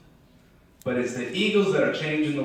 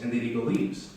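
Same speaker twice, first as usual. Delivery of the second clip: usual speaking voice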